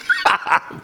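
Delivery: a burst of high laughter. Real, not acted. Preacher laughing at the prophet's sarcasm. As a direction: laugh out at the joke.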